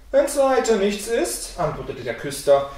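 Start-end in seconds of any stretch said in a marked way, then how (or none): 0.1-1.6 high, slow, easy reassurance; breezy sexton. A narrator's reading. Sexton answers the complaining father, breezily confident. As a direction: lightly dismiss the worry, pitched up.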